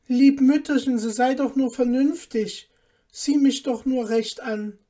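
pleading. A narrator's reading. Son begging his mother to look at him, pleading. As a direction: beg them to see reason.

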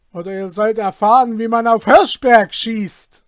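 loud, high commanding threat; thundering voice. Acted. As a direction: thunder down from the window like a lord. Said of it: "mit donnernder Stimme".

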